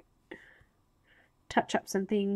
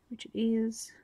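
whispering; slower than usual. Real, not acted. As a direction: breathe it out in a whisper. Whispered aside while colouring.

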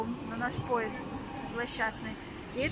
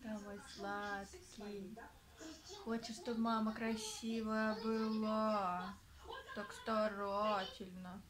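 нежное сюсюканье; 6 dB quieter, much slower than usual. Real, not acted. Mother cooing to her baby.

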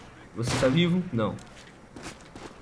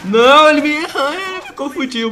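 excited yelp; 9 semitones higher, 8 dB louder than usual. Real, not acted. Reacting to a kill.

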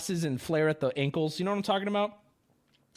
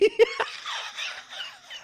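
squeaky high burst of laughter. Real, not acted. Streamer cracking up at chat insults, high laugh. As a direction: let a high laugh escape.

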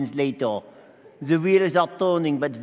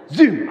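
loud quick zoom; zoom sound effect; 7 dB louder than usual. Acted. Angel arriving instantly, loud zoom. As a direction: fire off a punchy zoom.

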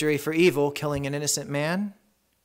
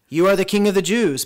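loud, raised proclamation; ringing declaration, higher and louder; declaring; 6 semitones higher, 8 dB louder than usual. A narrator's reading. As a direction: declare it publicly with authority. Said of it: "Pilate will later declare".

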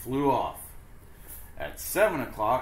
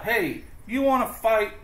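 much higher, loud challenge; grouchy ladybug; 11 semitones higher, 5 dB louder than usual. Acted. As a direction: shout up a fight. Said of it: "the grouchy ladybug said".

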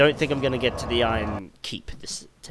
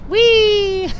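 very high, loud squeal; gleeful "whee"; 12 dB louder than usual. Pretend. Gamer watching a fall, gleeful "whee". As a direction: squeal like going down a slide.

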